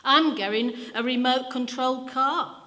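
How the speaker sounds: put-on high, loud child shouting his wish; a child shouting out a present wish. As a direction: yell it out over the others. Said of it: "children then to shout out".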